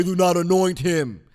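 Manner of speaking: slow, loud mock voice of God; God's voice. Frustrated man of God quoting God's refusal, slow boom. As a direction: boom it out slowly like God in a movie. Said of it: "use God's voice with a real deep voice".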